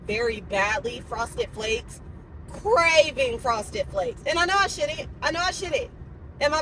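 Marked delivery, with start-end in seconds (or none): 0.1-4.2 drawn-out craving. Real, not acted.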